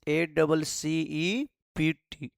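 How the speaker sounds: slow, letter by letter; spelling out letters. Shown as a demonstration. Teacher spelling a word for students, slow and separate. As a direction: spell each letter out slowly for the class. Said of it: "दिया है student accept".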